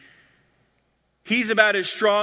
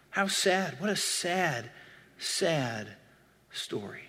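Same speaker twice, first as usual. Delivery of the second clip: sad lament; 8 dB quieter, slower than usual. Real, not acted. Preacher laments Peter's fall.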